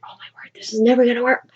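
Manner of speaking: quick, worried whisper; hurried fretful whisper to himself; panicked whisper. Retold or imitated. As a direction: whisper it fast, like a panicking schemer. Cyrano panicking under balcony.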